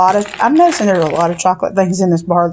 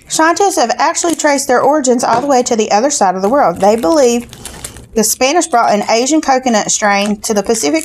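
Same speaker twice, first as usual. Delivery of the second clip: booklet read-aloud. Acted. Reads the snack booklet aloud.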